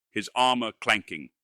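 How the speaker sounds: ordinary delivery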